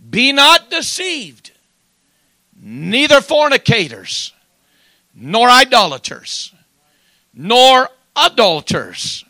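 loud, high, slowly drawn-out scripture proclamation; preacher's ringing warning from scripture; full-force preaching. Real, not acted. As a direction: thunder each item of the list, pausing between.

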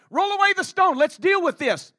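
loud, high ringing order; commanding. Acted. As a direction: call it out with authority. Voices Jesus commanding.

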